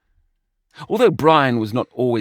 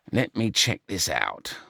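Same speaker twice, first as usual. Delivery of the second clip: lower, softer grunt; 4 semitones lower, 4 dB quieter than usual. A narrator's reading. Brian turning to his computer, grunting. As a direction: grunt it low under your breath. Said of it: "Brian grunted".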